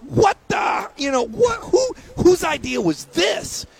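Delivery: high, loud, cracking cry of disbelief; who would do this, cried out in high exasperation; outraged yell. Retold or imitated. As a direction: shout it like a betrayed fan. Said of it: "And I'm going".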